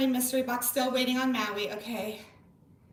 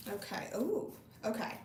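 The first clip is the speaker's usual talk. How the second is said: normal talking voice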